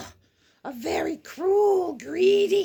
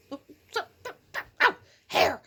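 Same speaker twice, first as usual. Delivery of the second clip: ordinary delivery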